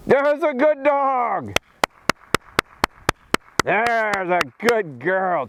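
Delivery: drawn-out, high praise to the dog; slow high praise. Real, not acted. Trainer praising his dog for the pick, slow and high. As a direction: stretch out the praise slowly. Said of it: "you see how my voice and my excitement".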